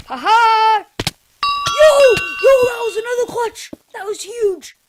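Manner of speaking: high, loud whoops; excited yelling. Real, not acted. Gamer cheering after another clutch, high and loud.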